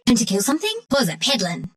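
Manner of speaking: ordinary talk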